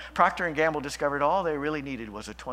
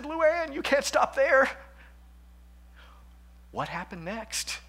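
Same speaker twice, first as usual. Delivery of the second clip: eager protest; 10 semitones higher than usual. Retold or imitated. Preacher retelling his own urging.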